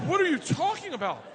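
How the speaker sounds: high-pitched baffled outburst; exasperated. Retold or imitated. Dad to daughter about deaf kids, exasperated. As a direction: demand an explanation, voice jumping up.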